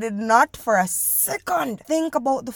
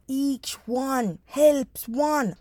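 slow emphasis; 3 semitones higher, much slower than usual. Acted.

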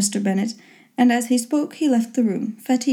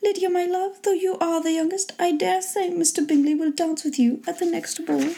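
high-pitched, effusive mother voice; high matronly chatter; fond mother; 7 semitones higher than usual. A narrator's reading. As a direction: voice a pleased mother addressing her girls.

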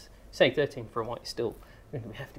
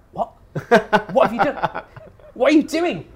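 high, loud, laughing mock exasperation; exasperated outburst; 10 semitones higher, 12 dB louder than usual. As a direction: re-enact your outraged disbelief, much louder and higher. Retells his exasperation at friend ruining joke.

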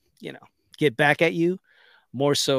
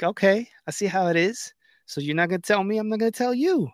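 hurt; 6 semitones higher than usual. Retold or imitated. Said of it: "I was like".

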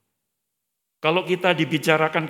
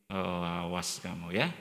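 low, slow, under-the-breath threat; a secret grudge muttered to oneself, slowly; 9 semitones lower, 10 dB quieter, slower than usual. Retold or imitated. Staff member secretly grudging the manager, a slow low mutter.

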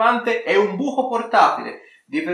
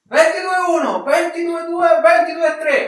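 auctioneer bid calling; 8 semitones higher than usual. Acted. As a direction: call out each bid like an auctioneer.

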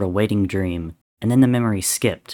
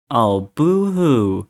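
drawn-out mock sympathy; mocking boo-hoo; 3 semitones higher, much slower than usual. A narrator's reading. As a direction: stretch it out to taunt him. Taunting a downhearted Dream.

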